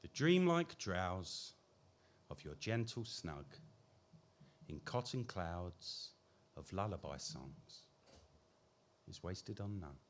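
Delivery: slow, measured poem recital; poetry reading. Acted. Poet reading his baby poem aloud, slow recital. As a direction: let each line settle, slowly.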